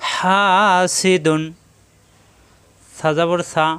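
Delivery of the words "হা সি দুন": long "হা", short "সি দুন"; one-alif madd. Shown as a demonstration. Teacher models madd word, held "হা" then brief "সি দুন". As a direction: stretch "হা" only, then "সি দুন" unstretched. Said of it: "এখানে কোনো টান নেই".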